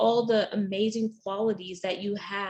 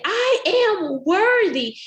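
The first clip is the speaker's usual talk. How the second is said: high, loud, slow and full of confidence; belted out; 11 semitones higher, 8 dB louder, much slower than usual. Shown as a demonstration. Coach belting out a confident affirmation. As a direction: belt out "I am worthy" with full confidence. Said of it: "you say it with confidence and you belt it out".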